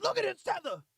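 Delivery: high-pitched eager jeer; excited. Acted. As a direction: crow it in a high mock voice. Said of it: "You get all excited".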